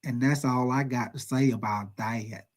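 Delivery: a hint of Forrest Gump, slow and low. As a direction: lightly imitate Forrest Gump, slowly and softly. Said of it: "like Forrest Gump would say".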